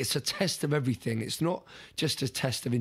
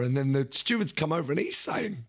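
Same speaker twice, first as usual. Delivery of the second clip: ordinary delivery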